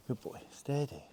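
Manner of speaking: hushed, near-whispered reassurance; soothing the horse. Real, not acted. Trainer calming a horse that kicks, hushed reassurance. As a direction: settle him down softly.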